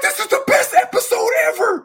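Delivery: high, loud, thrilled yell; excited kid shout. Retold or imitated. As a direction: shout it like a kid hooked on his cartoon. Kid excited by earthquake story.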